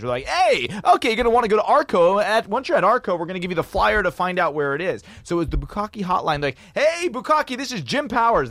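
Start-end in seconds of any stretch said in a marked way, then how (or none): 0.2-5.0 high, loud hotline announcer; rave hotline. Retold or imitated. Hotline host directs callers to a flyer, hyped up. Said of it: "like, an old rave hotline from the nineties". 6.8-8.5 high-pitched hotline host greeting; Jim Powers. Retold or imitated. Hotline host introduces himself to callers, high and hyped. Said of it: "That was his name".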